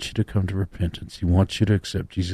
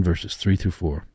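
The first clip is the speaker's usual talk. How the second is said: ordinary talk, nothing marked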